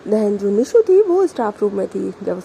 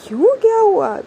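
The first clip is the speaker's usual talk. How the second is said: surprised; 10 semitones higher, 6 dB louder than usual. Acted. Friend surprised by sudden outburst.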